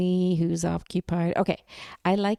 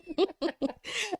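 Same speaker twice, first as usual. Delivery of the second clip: amused laugh; 13 semitones higher, 5 dB quieter than usual. Real, not acted. Friend apologizes, laughing.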